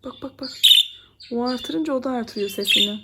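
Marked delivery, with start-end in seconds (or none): 0.0-0.5 soft hushed bak bak bak. Real, not acted. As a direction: hush it to the bird. Showing bird, hushed. (fısıltıyla)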